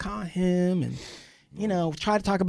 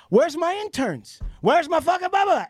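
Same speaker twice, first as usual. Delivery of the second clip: indignant mock rant; 10 semitones higher than usual. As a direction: act out a worked-up demand, pitched up. Acting out own indignant rant.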